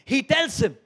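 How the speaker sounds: loud, high fervent preaching; rising, pounding sermon delivery; preaching at full force. Acted. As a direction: build it up like a revival preacher.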